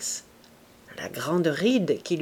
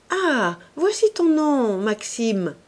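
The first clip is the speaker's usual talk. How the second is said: higher, louder, delighted exclamation; Renée finding Maxime's name on the mirror, exclaiming higher and louder; excited cry; 5 semitones higher, 4 dB louder, slower than usual. A narrator's reading. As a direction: call out the discovery gleefully. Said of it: "s'écria Renée".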